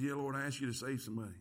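low, soft, quick confession; ashamed admission. Retold or imitated. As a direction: own up sheepishly under your voice. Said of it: "Boy, I felt so ashamed".

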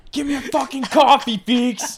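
high, loud joking holler; mock angry demand. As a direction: bellow a coffee order for laughs. Mock demand to person in studio.

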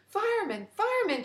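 high, loud frantic yell of fireman; a visitor yelling for help at the fire station. A narrator's reading. Loud frantic yell. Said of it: "she yelled".

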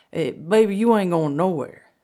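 loud, high refusal; acted insistence. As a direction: forbid it firmly, imitating a partner. Frank forbids her going out.

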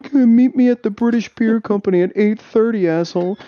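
high-pitched imitation of a challenge; high, mocking retold taunt. Mock challenge retold from game chat.

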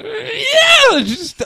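high piercing mock animal scream; a sudden high yell, acted out; imitated scream. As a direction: mimic the shriek you heard outside. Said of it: "this, like, scream".

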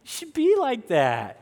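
high-pitched mock swooning listener; put-on high, cooing approval; gushing onlooker. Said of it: "some of you might be thinking".

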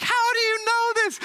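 shocked, almost squealing; astonished waitress. Retold or imitated. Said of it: "And she's like".

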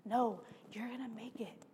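calm her down, keep her going; reassuring friend. Retold or imitated. Friend urging tired runner on, soft and reassuring. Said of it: "He goes".

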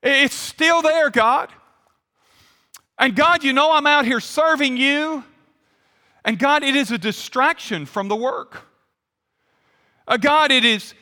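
high, insistent appeal to God; imitating an earnest petitioner, voice lifted; acted pleading prayer. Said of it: "and he says".

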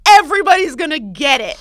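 very loud, high sarcastic protest; outraged rant. Real, not acted. Woman rants at neighbor's complaint, loud mock outrage. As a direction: protest at the top of your voice.

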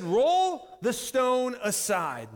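slow, loud, high-pitched order; angry command. Acted. Jesus at the tomb, still angry, ordering slowly and loudly. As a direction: stretch each word into a forceful command. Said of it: "Jesus was still angry".

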